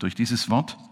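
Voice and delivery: ordinary delivery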